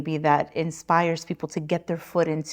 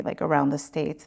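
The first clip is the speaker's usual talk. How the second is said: ordinary talk, nothing marked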